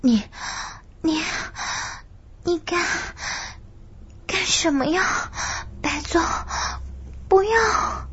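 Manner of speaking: high, tearful stammering plea; frightened crying plea. Acted. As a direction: stammer it out in panicked tears. Woken girl resisting, frightened.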